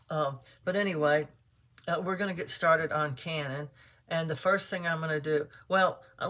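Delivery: usual speaking voice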